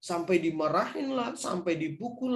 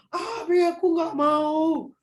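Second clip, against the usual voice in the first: put-on high, slow crying child refusing; 9 semitones higher, 4 dB louder, much slower than usual. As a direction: sob out the refusal like a small child. Child tantrum acted again.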